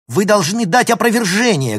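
high, loud furious demand; angry shout. A narrator's reading. Girl demands a retraction from professor, shouting angrily. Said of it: "гневно крикнула Алина".

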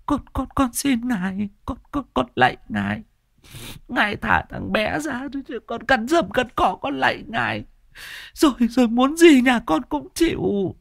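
put-on high, stammering old woman begging; pleading grandmother. A narrator's reading. Grandmother kneels and begs the ghost, high pleading. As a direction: beg the spirit on your knees.

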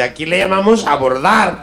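slow, Castilian Spanish correction with weight on llamamos; Spaniard's correction. Acted. Madrid local correcting the Mexican tourist, slow Castilian.

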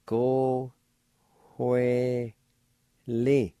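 Go, hue, li split apart, with a faint H breathed in hue; faint H sound. Shown as a demonstration. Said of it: "a faint H sound like this".

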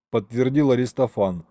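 usual speaking voice

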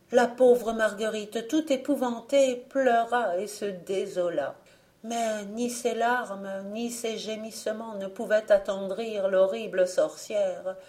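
ordinary delivery

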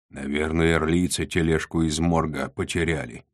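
ordinary delivery, nothing marked

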